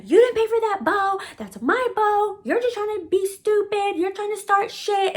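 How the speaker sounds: high-pitched imitation of an angry rant; shrill-pitched mocking tirade, not full volume; mock screaming. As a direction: mimic a confrontational mom in a high, strained voice. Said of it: "she starts screaming at me".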